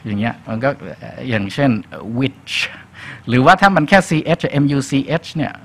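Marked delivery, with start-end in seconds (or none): 2.2-2.5 which ending in a crisp "tch"; touch the palate before the CH in which; tongue-tap CH. Shown as a demonstration. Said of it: "ต้องเอาลิ้นเข้าไปแตะ".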